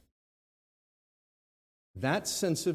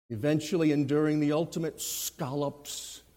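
ordinary talk

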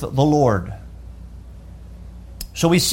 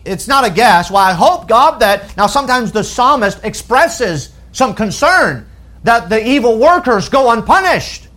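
raised, insistent pulpit delivery; emphatic preaching; 6 semitones higher, 4 dB louder than usual. Real, not acted. Preacher explaining the psalmist's certainty, emphatic preaching. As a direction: press the point with a higher voice.

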